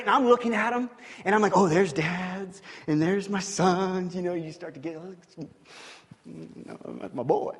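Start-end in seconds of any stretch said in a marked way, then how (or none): 1.6-7.5 fond reminiscing. Retold or imitated. Dad admiring the snow angels.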